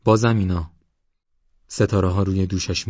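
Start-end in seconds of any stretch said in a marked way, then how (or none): 0.0-0.7 slightly raised voice; mildly raised exclamation. A narrator's reading. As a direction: call it out a little louder. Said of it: "راننده با صدای بلند گفت".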